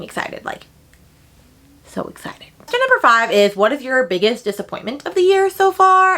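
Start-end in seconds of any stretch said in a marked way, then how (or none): 0.0-2.5 breathy whisper, barely there; whispered excitement. Real, not acted. Gushing to viewers about an upcoming book, whispered. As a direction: let the thrill fade into a whisper.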